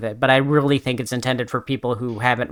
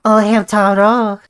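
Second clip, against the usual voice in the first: higher, louder mock French Hamtaro; French accent; 7 semitones higher, 5 dB louder than usual. Imitating Bijou calling Hamtaro, mock French accent. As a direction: coo the name in a French accent. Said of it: "reading it in her French accent".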